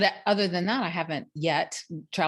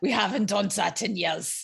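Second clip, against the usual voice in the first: put-on teasing German accent on "we haven't done that in years."; mock German accent. Teasing her friend, mock German accent. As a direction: echo the line as a German officer, lightly. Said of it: "Fräulein".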